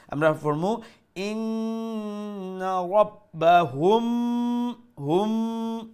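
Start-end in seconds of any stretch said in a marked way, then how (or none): 1.2-5.9 slow chanted ইন্না রব্বাহুম with a long hummed m; ikhfa shafawi. Shown as a demonstration. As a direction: hold the n of ইন্না and the m of হুম. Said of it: "ইখফা করি ফরমু".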